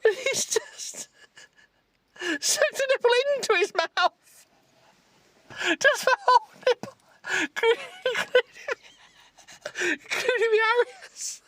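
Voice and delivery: high, squeaky words through helpless laughter; laughing fit. Real, not acted. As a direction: talk while crying with laughter. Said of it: "I can't stop laughing".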